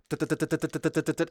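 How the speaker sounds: rapid duh duh duh; fast firing duhs. Shown as a demonstration. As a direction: rattle off fast mouth gunfire. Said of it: "the way that I had it was".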